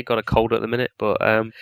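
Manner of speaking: ordinary delivery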